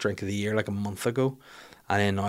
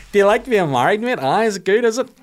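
higher, louder pushy banter at the groom; mock dry-mouthed heckle; 10 semitones higher, 5 dB louder than usual. Dry-mouthed comic ribbing the groom, higher and loud. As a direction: needle the groom, strained and loud.

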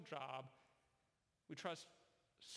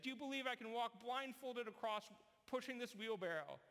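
higher, building showman challenge; showman's dare; 7 semitones higher than usual. Retold or imitated. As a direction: raise the stakes for the crowd. Performer challenges the crowd.

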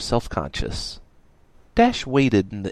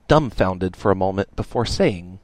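plain reading voice

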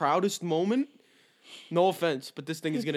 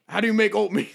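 louder, higher mock dad asking the phone; 4 semitones higher, 6 dB louder, faster than usual. Imitating [name]'s dad asking Siri a question, raised voice. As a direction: imitate a dad addressing a voice assistant. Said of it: "That's how [name]'s dad says".

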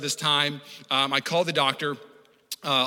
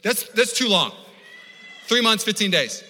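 dismayed protest; 7 semitones higher, 4 dB louder, slower than usual. Retold or imitated. Retold protest at long appointment wait.

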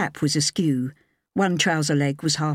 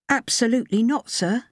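higher, lighter woman's voice; young woman voice; 7 semitones higher than usual. A narrator's reading. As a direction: voice her higher and clear.

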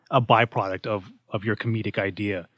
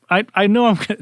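higher, louder, shrill imitated sob; high-pitched fake-tearful fairy; mock crying; 11 semitones higher, 7 dB louder, faster than usual. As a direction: imitate a fairy bawling mid-sentence. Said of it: "she's crying".

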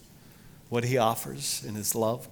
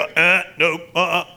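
mock refusal; 7 semitones higher, 11 dB louder than usual.

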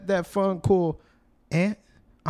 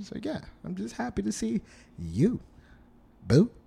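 leering; 6 semitones higher than usual. Acted. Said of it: "See how I did that?".

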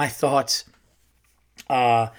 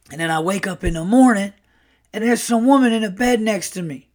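higher-pitched brash yarn-spinner; animated brash storyteller; Earl; 6 semitones higher, 3 dB louder than usual. Retold or imitated. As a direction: imitate a brash uncle reliving a drunken morning. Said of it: "like, a very brash uncle".

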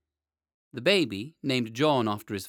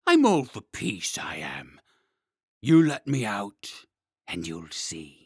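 growling dragon guarding treasure; 4 semitones lower, 5 dB louder, slower than usual. Acted. Dragon asks to be freed.

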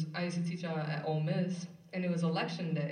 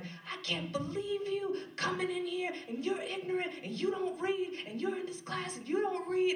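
retold angry rant; 13 semitones higher than usual. Student going off at classmate who doesn't read, imitated high-pitched rant. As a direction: imitate her outraged tirade, pitched way up. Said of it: "And she was going off".

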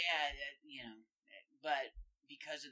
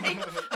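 high, loud burst of laughing; loud laughter; 10 dB louder than usual. Real, not acted. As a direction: burst out laughing at your own story. Group laughs at the story.

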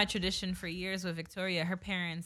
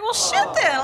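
excited; 8 dB louder, slower than usual. Real, not acted. Excited reaction to free flight.

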